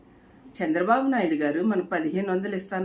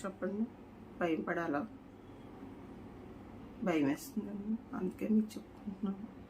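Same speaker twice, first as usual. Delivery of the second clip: quiet fearful plea; 8 dB quieter than usual. Real, not acted. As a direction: let the voice drop to a scared hush. Softly pleading after the laughing. (భయంతో మెల్లగా వేడుకోలు)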